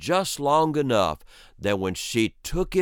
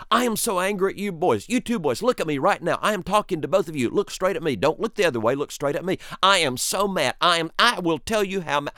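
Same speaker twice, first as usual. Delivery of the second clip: higher, lightly voiced scolding mother; hammed-up scolding, stretching never never never; mock-angry mother; 6 semitones higher than usual. Mother scolding her two boys, a light mock scold. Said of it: "She was so mad. She was saying".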